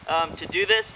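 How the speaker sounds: ordinary talk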